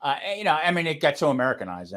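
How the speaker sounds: ordinary talk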